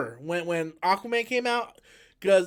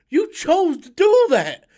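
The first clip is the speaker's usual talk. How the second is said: indignant outburst; 8 semitones higher, 9 dB louder, slower than usual. Real, not acted. Ranting at the filmmakers.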